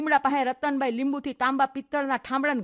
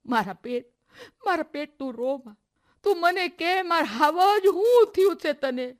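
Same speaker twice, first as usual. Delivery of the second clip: soothing then rising to a high frightened plea; a mother terrified for her child; frantic pleading; 7 semitones higher, 6 dB louder than usual. Acted. Mother comforts then begs sobbing daughter, growing frantic.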